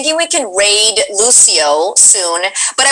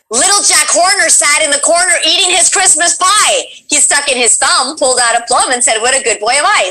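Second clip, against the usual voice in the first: lilting, higher-pitched rhyme recitation; higher voice, swooping through the rhyme; nursery-rhyme recital; 6 semitones higher than usual. Acted. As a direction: recite it in a bouncy singsong.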